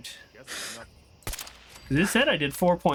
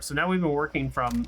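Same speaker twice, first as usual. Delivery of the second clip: normal talking voice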